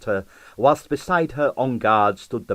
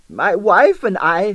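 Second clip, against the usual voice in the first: put-on Russian accent; 10 semitones higher, 7 dB louder, slower than usual. A narrator's reading. Confiding husband.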